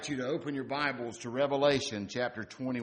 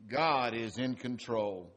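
normal talking voice

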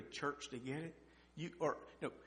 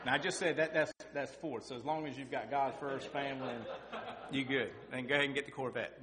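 fired-up preaching; 2 semitones higher, 7 dB louder than usual. Acted. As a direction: preach it loud to the whole room. Preaching at full force.

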